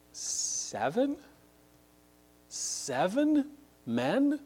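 high, rising, disbelieving questions; incredulous. Real, not acted. Preacher compares five men with seven, high-pitched incredulity. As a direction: repeat it in amazement, pitch leaping up.

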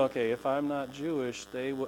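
hushed uneasy realization; nervous reader. Retold or imitated. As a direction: murmur it as it sinks in.

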